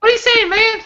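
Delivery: high, laughing Kevin Hart squeak; Kevin Hart impression. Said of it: "I sound like Kevin Hart".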